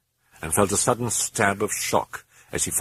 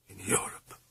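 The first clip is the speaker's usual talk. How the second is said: a soft, hushed reply; quiet, defeated whisper; 2 semitones higher, 11 dB quieter than usual. Acted. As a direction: barely voice it. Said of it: "she whispered".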